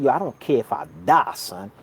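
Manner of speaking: low and quiet, dead serious; low quiet vow. Retold or imitated. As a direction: say it low like an oath to himself. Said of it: "I said".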